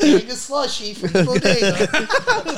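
laughing. Real, not acted. Laughing joke.

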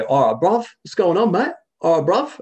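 put-on high, loud London bruv accent; cheeky Londoner greeting a mate; English accent. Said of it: "Or you can do".

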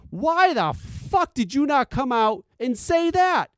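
high, slow, furious demand; high-pitched indignant rant; exasperated outburst. Real, not acted. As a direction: throw the question at them in disbelief.

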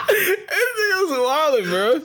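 high squealing laugh through words; cracking up, can barely talk; laughing hard. Real, not acted. Cracking up at slang list.

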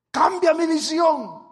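loud, high, slow plea; fervent preaching prayer. Acted. Preacher praying before the congregation, loud high plea. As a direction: cry out for change.

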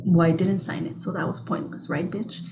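soft, hushed self-scolding; mock scolding herself quietly; muttering to self. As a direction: murmur it to yourself, trailing off. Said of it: "And then I'm like".

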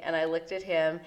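normal talking voice

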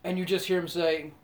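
ordinary delivery

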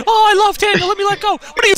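high, mocking girly voice; mock wrestler voice. Mocking Brie letting go of the hold, high mock voice. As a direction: ham up her lovestruck thoughts.

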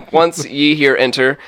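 normal talking voice

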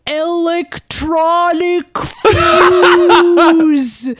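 drawn-out, very slow slow-motion voice; slowed down deep. Retold or imitated. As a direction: stretch every sound like slowed-down footage. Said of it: "in a slowed down voice goes".

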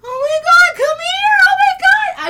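high-pitched, loud fussing mommy; instant mommy. Acted. Mommy calling a crying man over, very high, loud mothering voice. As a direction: cry out like a mother rushing to comfort. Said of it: "I become this instant mommy".

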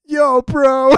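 high, loud dopey bro shout; hammed-up bro call, pushed high; Robbie E. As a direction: imitate a goofy wrestler's catchphrase. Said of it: "Robbie E being like".

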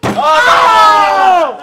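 crie fort, surpris; un Oh! lancé à pleine voix; cri de surprise. Real, not acted. Réaction au jet de dés. (cri de surprise soudain)

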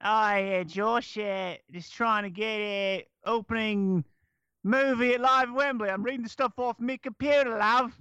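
high, thick fake Aussie drifting British; Australian accent attempt. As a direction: improvise in a bad Australian accent. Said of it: "let me hear your Australian again".

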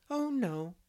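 a light cheerful denial. A narrator's reading. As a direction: brush off worry cheerfully. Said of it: "returned Anne cheerfully".

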